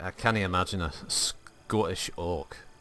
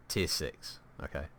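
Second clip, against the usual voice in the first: normal talking voice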